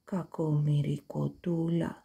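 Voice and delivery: soft, slow inner thought; quiet slow thought; thinking to himself. A narrator's reading. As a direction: pity muttered under the breath. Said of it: "σκέφτηκε ο Λύκος Ζαχαρίας".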